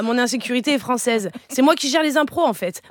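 ordinary delivery

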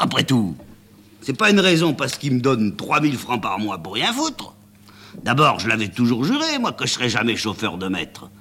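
le chauffeur s'emporte, voix plus aiguë; râler contre son patron en s'échauffant; colère montante. Acted. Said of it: "se mettre en colère tout seul". (le chauffeur se met en colère tout seul, voix montée)

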